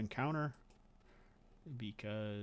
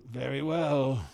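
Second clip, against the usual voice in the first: ominous acceptance; 3 semitones higher, 8 dB louder than usual. Acted. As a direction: accept the challenge with menace. GM voices the NPC.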